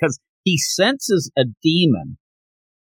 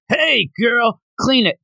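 mock demon shout; 8 semitones higher, slower than usual. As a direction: yell it at the servant girl like a cruel mistress. Demon barks order at girl.

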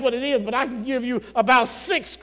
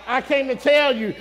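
preaching at full force; 6 semitones higher, 7 dB louder than usual. Acted. Preacher shouting to the congregation that crying is fine.

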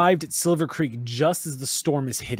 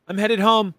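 higher, louder sign-off in character; Tara; 7 semitones higher, 5 dB louder than usual. Acted. Guard leaving for home, signing off in a raised voice. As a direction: lift the pitch and call it out. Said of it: "Tara goes".

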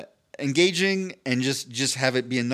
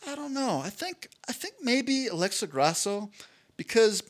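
imitating Cub Swanson; 6 semitones higher than usual. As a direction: play it higher and gentle, half-joking. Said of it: "he's like".

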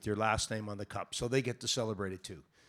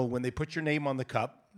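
ordinary talk

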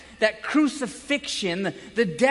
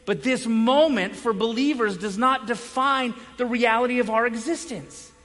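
normal talking voice

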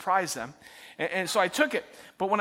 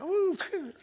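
mock dismissive ooh; 12 semitones higher than usual. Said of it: "I'm like".

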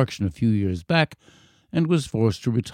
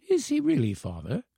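put-on high child's voice, dropping at the end; amazed boy; 6 semitones higher, 6 dB quieter than usual. A narrator's reading. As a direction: ask father as an incredulous boy. Boy checks with his father.

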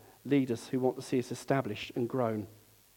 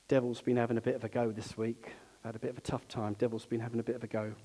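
lightly acted weary churchgoer, low and soft; 4 semitones lower, 8 dB quieter, faster than usual. Preacher imitating Christians talking about their week, downbeat grumble. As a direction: mutter it low, like a mild complaint. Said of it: "Christians like me say things like".

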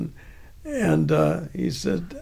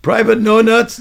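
loud, higher mock roll call; sergeant's mocking name; 5 semitones higher, 6 dB louder than usual. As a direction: bark the insulting nickname.

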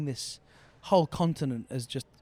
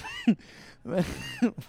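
high laugh; 5 dB louder than usual. Real, not acted. Laughs at own voice.

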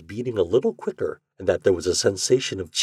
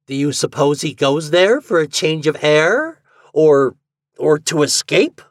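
loud, high, insistent questioning; louder voice; 8 semitones higher, 7 dB louder than usual. A narrator's reading. Garvey pressing the secretary, loud and high. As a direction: raise the voice and push the question. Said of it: "he went on in a louder voice".

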